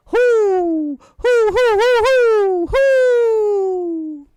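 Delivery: high, loud hoots, each one falling; the owl answers from the trees with hoots; answering owl call. A narrator's reading. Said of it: "an echo came threading its way".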